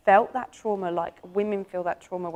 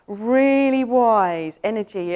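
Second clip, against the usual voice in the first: ordinary talk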